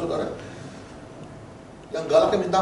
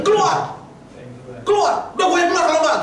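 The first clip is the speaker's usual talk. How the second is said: high, raised-voice exorcism command that gives way to nerves; mock-scared command; 7 semitones higher than usual. Young minister casting out a demon, scared himself. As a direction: order it out while acting out your fright. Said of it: "kita juga takut".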